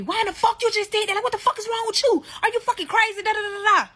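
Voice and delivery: high-pitched mock yell; frantic retold rant, trailing into nonsense syllables; imitated yelling. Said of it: "So he yelling at me".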